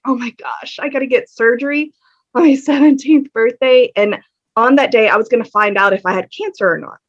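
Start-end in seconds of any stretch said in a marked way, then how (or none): 0.0-3.9 rising, half-laughing disbelief; a teen's mock-horrified oh my gosh; disbelieving dismay. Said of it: "was I'm like".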